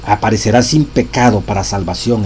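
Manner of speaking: loud, solemn reading of a sacred text; preacher reading scripture with force; reading a quotation aloud. Acted. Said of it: "Oigan esto".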